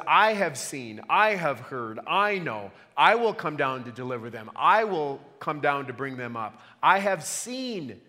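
each "I" punched at the start of every clause; stress on I. Shown as a demonstration. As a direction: lean hard on every "I" God says. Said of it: "the subject in all the sentences is God".

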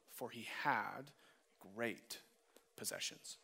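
soft, measured Bible reading; scripture reading. Acted. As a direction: read the passage quietly and solemnly. Reads the scripture passage aloud.

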